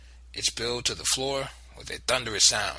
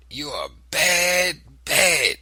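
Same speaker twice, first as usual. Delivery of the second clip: slow, tearful and loud; weeping; 6 semitones higher, 8 dB louder, much slower than usual. Acted. Grandmother weeping at the boy, tearful. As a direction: say it through tears.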